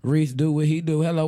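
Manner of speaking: ordinary delivery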